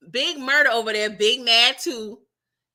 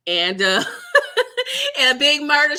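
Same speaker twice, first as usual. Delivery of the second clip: laughing through the words; cracking up while getting the words out; 3 semitones higher, 5 dB louder than usual. Real, not acted. Laughing at her own joke about grandma.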